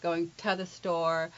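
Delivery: "to" as tuh, slowed for demonstration; slowly, with "to" weakened to a schwa; reduced "to". Shown as a demonstration. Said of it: "we say ta".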